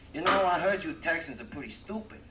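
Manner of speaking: mock New Yorker. New Yorker taunts Texan in joke.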